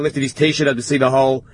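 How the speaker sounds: ordinary talk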